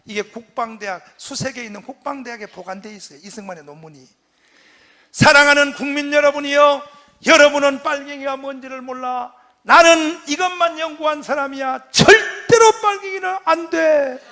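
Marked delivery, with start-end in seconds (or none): 5.1-14.2 fiery speech. Retold or imitated. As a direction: preach it to the crowd. 이승만 연설을 재현. (군중 앞 열변)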